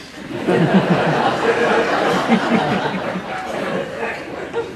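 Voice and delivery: a big hearty burst of laughter; loud laugh. Real, not acted. Telling the audience about following Wallace, loud laugh. Amused at being thrown out.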